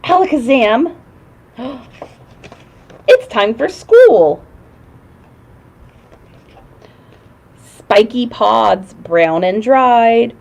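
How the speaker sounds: put-on lilting kids' show host voice; singsong puppet show host. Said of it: "reading magic words book to kids".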